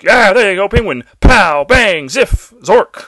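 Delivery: loud, high comic-book cheering; mock producers. Host mimicking producers egging on Penguin, loud cartoonish excitement. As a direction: punch out each cartoon sound effect. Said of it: "producers were like".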